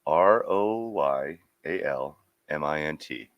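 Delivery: slow, letter by letter; spelling slowly. Real, not acted. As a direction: spell each letter out clearly. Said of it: "spelling out letters".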